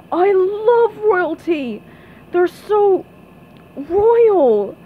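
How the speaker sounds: slow, drawn-out gushing, rising in pitch and volume; brightening. Acted. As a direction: gush with growing royal adoration. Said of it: "but then he brightened".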